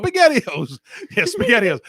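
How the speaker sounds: Italian-American "spaghettios" stretched out; Italian-American accent. Shown as a demonstration. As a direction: ham up a Jersey Italian voice. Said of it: "Pretend you're speaking Italian".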